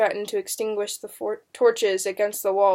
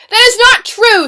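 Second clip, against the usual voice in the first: high, loud, outraged denial; shrieked repeat; 13 semitones higher, 15 dB louder than usual. A narrator's reading. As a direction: cry the denial out at the top of the voice. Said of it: "Susanna repeated".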